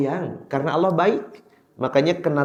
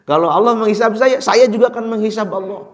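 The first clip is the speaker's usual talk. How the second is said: louder and higher, a stubborn village man declaring it; defiant villager; 10 semitones higher, 10 dB louder than usual. Acted. The preacher repeats the villager's words to the congregation, louder and defiant. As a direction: fling it out like a plain man daring heaven. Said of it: "Wah, orang kampung gitu".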